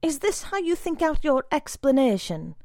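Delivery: rising fury; furious scolding. A narrator's reading.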